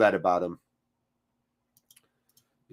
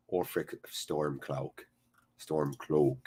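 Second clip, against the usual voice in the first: much lower, much softer, slow name intoned; lightly voiced jarl; 9 semitones lower, 11 dB quieter, slower than usual. Streamer greeting the Dragonborn fan in a low jarl voice. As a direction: murmur the name like a brooding king. Ulfric voice for chatter again.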